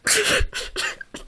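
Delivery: breathy high giggle; wheezing laugh. Real, not acted. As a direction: gasp with laughter. Laughing fit during reading.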